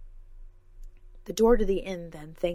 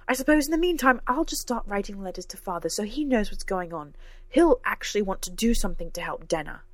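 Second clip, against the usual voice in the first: raised, pointed sarcastic retort; overt sarcasm; 3 semitones higher, 5 dB louder than usual. Acted. Cora threatens to write to Father, sarcastic and heated. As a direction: lay the sarcasm on, voice lifted. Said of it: "Cora's overt display of sarcasm".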